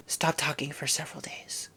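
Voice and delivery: hushed deadpan whisper; soft dismissive whisper. Acted. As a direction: whisper it flatly. Whispered follow-up.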